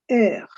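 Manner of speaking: "R" said as "air" with a guttural end; French letter name "R", rasped in the throat. Shown as a demonstration. Teacher naming the letter, guttural French R. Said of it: "The name of the letter is R".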